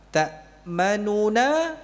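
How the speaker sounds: Ta'manuna said slowly with a full double nun; original form. Shown as a demonstration. Teacher showing the original Ta'manuna form. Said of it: "asalnya demikian".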